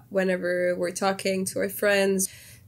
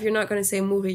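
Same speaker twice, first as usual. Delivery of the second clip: ordinary talk, nothing marked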